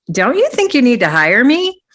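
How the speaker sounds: playful, high-pitched question; joking. Retold or imitated. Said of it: "And I jokingly say".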